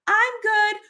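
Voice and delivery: high, light "I'm good"; friend's brush-off. Retold or imitated. A friend dodging the check-in with a high, light answer.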